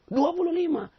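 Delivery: high, loud, squeaky dua puluh lima; stubborn man squeaking his wrong answer; stubborn insistence. Acted. Stubborn man insists on twenty-five.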